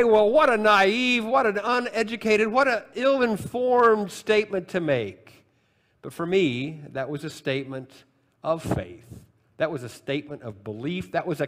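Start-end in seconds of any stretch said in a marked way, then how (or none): 0.0-5.4 mock scorn. Preacher voicing critics of the old man's answer, mock scornful. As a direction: pile on the exaggerated scorn, item by item.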